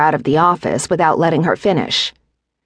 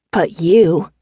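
mild challenge to him; challenging. A narrator's reading. Wife to grinning husband, challenging. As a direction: pointedly dare him to disagree. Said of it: "she challenged".

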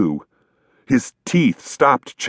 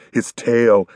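ordinary delivery

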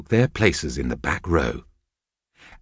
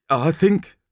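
sad sort of voice; 9 semitones higher than usual. A narrator's reading. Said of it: "in a sad sort of voice".

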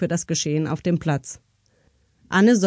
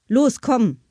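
high, sudden urging; urgent command; 6 semitones higher, 4 dB louder than usual. A narrator's reading. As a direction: hurry her along, pitched up. Mother suddenly urges daughter away. (plötzlich drängend, mit hoher Stimme)

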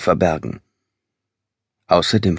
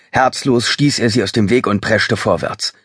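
ordinary delivery

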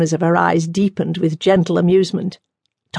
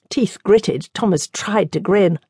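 plain reading voice

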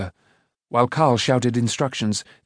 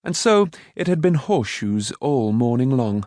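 ordinary delivery

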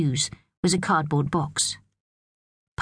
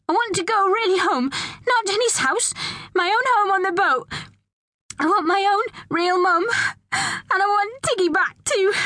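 put-on very high child's wail; distressed shouting. A narrator's reading. Pearl demanding her own home and mum, distressed shouting. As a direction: cry out desperately, pitched right up. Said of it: "shouted Pearl".

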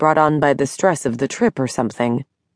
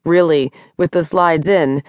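ordinary delivery, nothing marked

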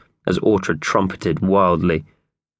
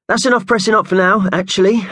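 puffing; 12 semitones higher, 3 dB louder than usual. Acted. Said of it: "puffed Mickey Thompson".

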